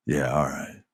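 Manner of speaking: a hint of a menacing champion; grudging Roman. Roman finally nodding to Sami, low grudging agreement.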